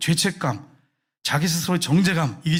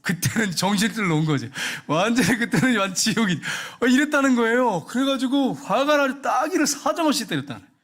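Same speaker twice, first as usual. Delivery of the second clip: worked-up retelling; 5 semitones higher than usual. Retold or imitated. Comic retelling of losing control.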